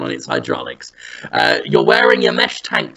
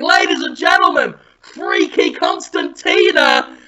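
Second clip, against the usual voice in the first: mock screamed announcement; 10 semitones higher than usual. Party host with a microphone, mock scream. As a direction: announce the star act, pitched way up.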